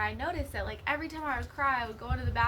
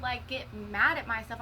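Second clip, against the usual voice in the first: ordinary talk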